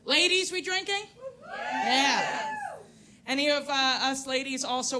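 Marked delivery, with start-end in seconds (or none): 1.5-2.5 squealed cheer. Real, not acted. Crowd cheer call-back.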